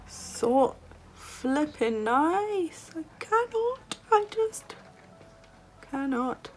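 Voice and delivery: high, squeaky swooning; overwhelmed gushing. Real, not acted. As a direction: squeal as if unable to cope. Overwhelmed by the shade.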